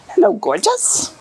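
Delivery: high sweet cooing greeting; cooing to a pet. Real, not acted.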